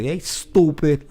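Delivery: mocking taunt "stupid"; stooopid. Shown as a demonstration. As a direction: drag "Hey, stupid" out. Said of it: "you can tell when people say it".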